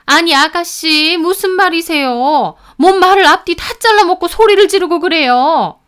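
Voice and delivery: high, loud, affronted retort; indignant protest. A narrator's reading. Sister-in-law answering the shouting sister, indignant protest. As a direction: push back loudly, offended.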